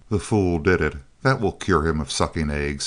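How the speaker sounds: ordinary delivery, nothing marked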